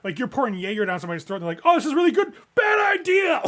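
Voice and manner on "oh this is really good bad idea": very high, very loud screech; shouting. Retold or imitated. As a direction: scream it like a drunk kid. Kids shouting at bar.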